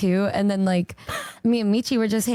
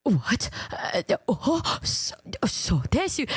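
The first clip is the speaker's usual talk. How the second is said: shocked stammer; 3 dB quieter, slower than usual. Retold or imitated. Reenacts shocked reaction to answer.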